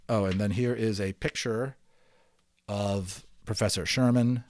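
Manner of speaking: ordinary delivery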